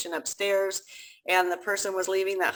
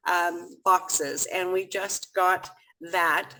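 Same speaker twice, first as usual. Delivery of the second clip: ordinary talk, nothing marked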